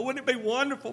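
ordinary delivery

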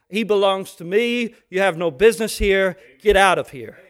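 growing forceful casting-out; commanding rebuke. Acted. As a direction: drive the devil out. Jesus voiced casting out devil.

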